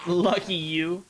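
normal talking voice

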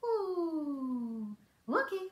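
usual speaking voice

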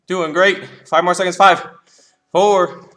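loud, driving countdown; shouting encouragement. Real, not acted. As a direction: push the class with a loud call. Coach counting down the workout.

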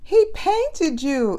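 high, slow, sing-song tease; giggly teasing. A narrator's reading. Annie teases the paint-covered detective, high sing-song. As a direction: draw it out, amused.

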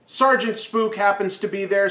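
ordinary delivery

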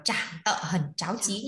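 "chảng tợ hẩn cháo chí" read slowly, each syllable separate; slow teacher model of "chảng tợ hẩn cháo chí". Shown as a demonstration. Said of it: "Từ đọc là".

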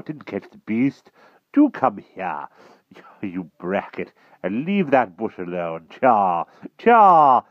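blustering knight scolding his dog, loud bursts; flustered King Pellinore. A narrator's reading. Knight king orders his unruly dog about, flustered. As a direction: break off to call the dog to heel, loudly.

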